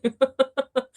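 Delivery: high giggle; excited high-pitched laughter; giddy laugh. Real, not acted. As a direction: burst into high, overwhelmed giggles. Laughs after admitting being overwhelmed.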